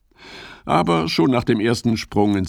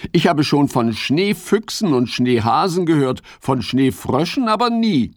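higher, lively frog voice; Fips the frog; 7 semitones higher than usual. Acted. Fips tells Ole what he has heard, curious. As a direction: play a curious little frog.

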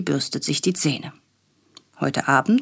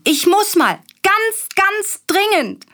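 put-on high, slow insistent little boy; urgent child; 4 dB louder, slower than usual. A narrator's reading. Willi calling Papa that he needs the potty, high and urgent. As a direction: plead like a child who really has to go. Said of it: "ruft Willi".

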